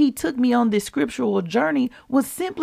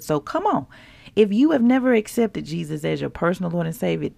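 much softer, hushed sincere invitation; a quiet plea to accept Jesus; gentle altar call; 6 semitones lower, 5 dB quieter, faster than usual. Acted. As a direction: invite them in quietly and earnestly. Soft salvation invitation.

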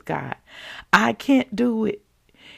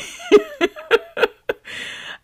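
a high burst of laughter; bright laugh; 4 dB louder than usual. Real, not acted. Laughs at own remark.

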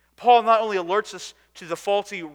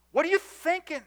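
high, firm mock rebuke to the groom; stern in love; 10 semitones higher, 3 dB louder than usual. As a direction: scold him, but with love. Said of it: "sternly in love".

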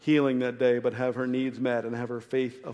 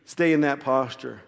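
usual speaking voice

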